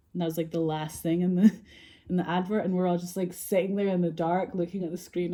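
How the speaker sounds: ordinary talk, nothing marked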